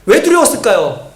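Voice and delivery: ordinary delivery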